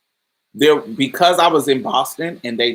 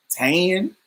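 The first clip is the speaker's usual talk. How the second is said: TAN drawn out country-style, higher pitched; exaggerated country accent; 5 semitones higher than usual. Shown as a demonstration. Mimicking what Boston classmates heard, exaggerated country accent. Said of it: "it's like I'm saying tan".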